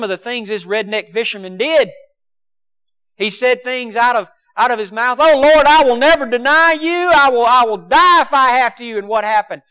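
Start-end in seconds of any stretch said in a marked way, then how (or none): 5.2-9.0 high, loud, earnest protest of loyalty; fervent loud vow. Retold or imitated. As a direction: swear it loudly like an impetuous disciple. Peter's boastful vow retold.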